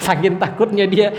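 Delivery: chuckle, then talk through the laugh; real amused laughter running into the words. Real, not acted. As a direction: laugh at someone's timid excuse. Amused by the reluctant friend's excuse.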